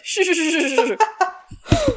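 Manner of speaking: playful hush through laughter; laughing shush. Real, not acted. Hushing a spoiler, laughing.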